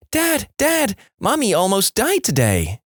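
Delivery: put-on high-pitched little-boy alarm; panicked child. A narrator's reading. Johnny runs to tell dad about Mommy, panicked kid. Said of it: "in a state of panic".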